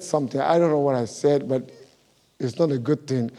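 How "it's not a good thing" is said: usual speaking voice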